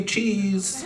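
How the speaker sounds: high, soft "Cheese" like a photographer; a light photographer's "Cheese". Retold or imitated. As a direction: coax a smile for the camera.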